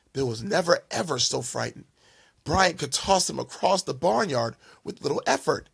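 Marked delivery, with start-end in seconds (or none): none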